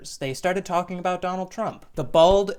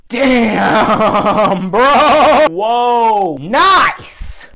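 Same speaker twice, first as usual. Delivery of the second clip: much higher and louder, with a laugh; thrilled stranger raving about his mango; loud laughing delight; overjoyed; 8 semitones higher, 14 dB louder, much slower than usual. Retold or imitated. Touraj loving the mango slice.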